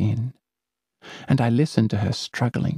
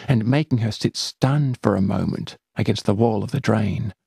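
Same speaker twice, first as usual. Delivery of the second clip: usual speaking voice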